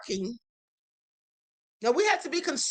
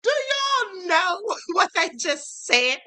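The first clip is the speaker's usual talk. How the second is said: words squeezed through laughter; talking while cracking up; laughing; 4 semitones higher, slower than usual. Real, not acted. Laughing fit over article.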